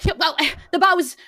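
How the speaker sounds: high, loud, rushed apology; flustered bartender. Acted. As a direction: burst in late and out of breath. Bartender rushing in breathless.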